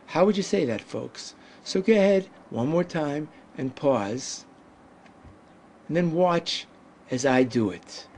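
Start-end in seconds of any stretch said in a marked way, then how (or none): none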